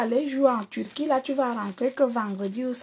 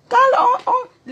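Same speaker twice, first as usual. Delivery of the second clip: mocking laugh; 11 semitones higher, 7 dB louder than usual. Real, not acted.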